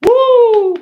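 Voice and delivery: high, loud whoop; sudden ecstatic holler; excited woo. Real, not acted. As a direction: let out a big happy whoop. Excited shout.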